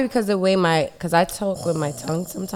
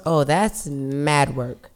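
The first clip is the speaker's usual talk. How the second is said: usual speaking voice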